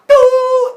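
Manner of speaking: long, very high, loud boom noise; explosive boo. Retold or imitated. Zeus bursts into true form, explosive boo. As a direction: blast out a drawn-out high boo. Acting Zeus's transformation.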